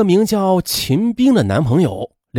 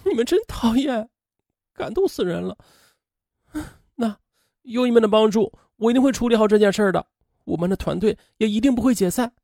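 high, soft tearful voice; choked up; 5 semitones higher, 4 dB quieter than usual. A narrator's reading. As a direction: act a moved girl holding back tears. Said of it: "他哽咽着说". (哽咽着说，又高又轻)

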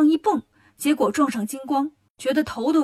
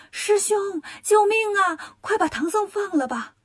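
high-pitched pleading spider spirits; urgent cry for help; 7 semitones higher, slower than usual. A narrator's reading. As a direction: plead for rescue in a high, drawn-out call. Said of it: "蜘蛛精们赶紧喊". (尖声呼救)

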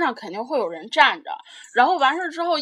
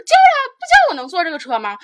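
louder, higher huffy comeback; indignant retort; 7 semitones higher, 7 dB louder than usual. Retold or imitated. Seat taker snaps back at the girl holding seats, indignant and louder. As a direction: throw it back at her like it's obvious.

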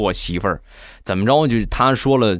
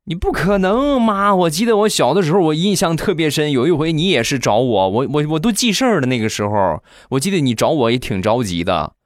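high, insistent grown son pleading with his mother; a grown son's high-pitched protest; 7 semitones higher than usual. Acted. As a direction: push back high, sure he was missed.

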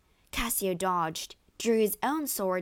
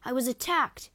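higher-pitched, urgent appeal; begging; 5 semitones higher than usual. A narrator's reading. Wounded man explains to the newcomer, begging. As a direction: plead your case as the victim. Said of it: "begged Cassio".

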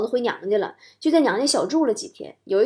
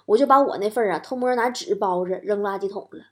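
ordinary talk

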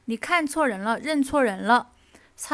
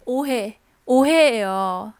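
slow, drawn-out coy plea; coy pleading; 8 dB louder, slower than usual. Shown as a demonstration. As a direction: stretch it sweetly. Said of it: "这是误会". (慢慢撒娇)

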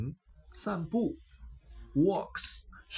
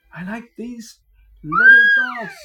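excited shout; 14 dB louder than usual. A narrator's reading. Peter excited about the dogs.

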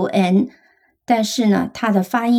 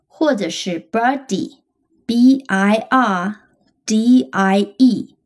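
slow spelling; much slower than usual. Shown as a demonstration. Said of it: "如果你能记住racket".

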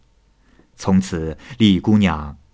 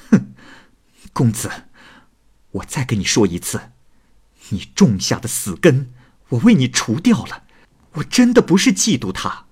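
indignant huff building to high protest; angry; 4 semitones higher than usual. A narrator's reading. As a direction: start controlled, rise into heated insistence. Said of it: "莲香大怒". (怒气渐升地辩白)

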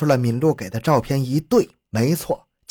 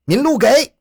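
high, loud calling out; loud shout; 11 semitones higher, 6 dB louder than usual. A narrator's reading. Policeman calling after a fleeing old woman, loud shout. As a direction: yell the name after someone fleeing. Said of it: "熊斌边追边高声喊道".

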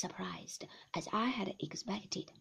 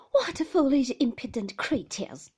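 louder, higher playful outcry; cried out laughingly; 4 semitones higher, 8 dB louder than usual. A narrator's reading. Mother laughing off the men's flattery, crying out playfully. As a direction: scold them teasingly, half laughing. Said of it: "cried my mother, laughing".

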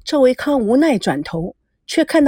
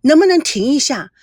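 higher, excited call to the driver; higher excited shout to stop the car; sudden call; 6 semitones higher, 4 dB louder than usual. Acted. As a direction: call out, delighted by the view. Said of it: "孙娜突然地喊道".

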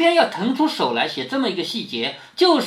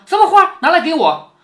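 演宝玉急着要看花，音高声响; 抢着开口，高声讨花; 急切索要; 9 semitones higher, 7 dB louder than usual. Acted. 演宝玉一听有花就抢着要. (急切地高声索要)

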